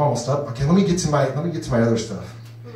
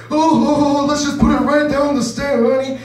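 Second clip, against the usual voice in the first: put-on high, loud old man; mock frisky old man; old husband voice; 11 semitones higher, 3 dB louder than usual. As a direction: play the eager old husband. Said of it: "use different voices".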